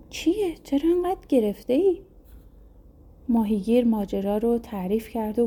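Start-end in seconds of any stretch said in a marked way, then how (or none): 0.1-2.0 lightly voiced worried wife, higher; a hint of a concerned wife asking what's wrong. A narrator's reading. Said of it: "زنش پرسید".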